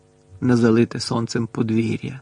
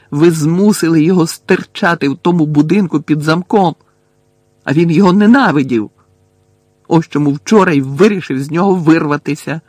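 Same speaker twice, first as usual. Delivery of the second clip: raised voice, higher and louder, accusing; heated, high-pitched reproach; angry accusation; 4 semitones higher, 8 dB louder than usual. A narrator's reading. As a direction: throw the blame at him loudly. Harry angrily accuses Dumbledore.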